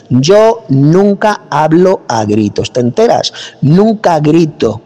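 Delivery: slow, deliberate, pointedly calm; calm normal tone. Real, not acted. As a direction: spell it out slowly to prove you never shout. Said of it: "Te estoy hablando correctamente y en un tono normal".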